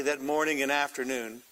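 normal talking voice

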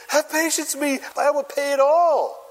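high-pitched mock pleading; begging. Fellow servant falls at his feet, acted begging. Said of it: "begged him, saying".

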